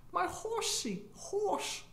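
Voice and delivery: high, soft mock British "horse"; mock British "horsey"; posh British. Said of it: "how would a British person pronounce horse".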